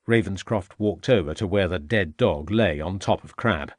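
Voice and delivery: plain reading voice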